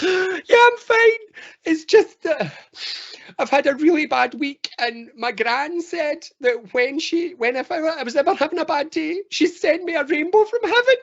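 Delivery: high-pitched hammed-up sobbing; crying. Tearful walker insisting to the group they are fine, hammed-up crying. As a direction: wail it through mock tears. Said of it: "they're crying their eyes out".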